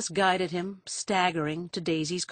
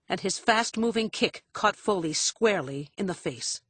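ordinary delivery, nothing marked

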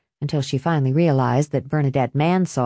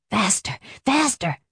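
panting; 4 semitones higher than usual. A narrator's reading. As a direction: pant the words out, lightly done. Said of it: "Lester panted".

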